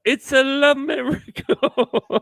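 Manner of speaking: very high, slow, hammed-up French accent; French accent sliding into Mario-style Italian. Parents announcing their miracle baby, high mock French accent. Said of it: "your French accent sound like Mario".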